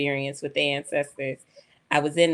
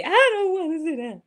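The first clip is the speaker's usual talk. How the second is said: put-on higher, faster whine of a little girl; whiny child refusing; 12 semitones higher, 3 dB louder, faster than usual. Daughter in the hair shop refusing to sit, higher, faster whine. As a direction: whine it like a stubborn kid. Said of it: "she's crying and screaming".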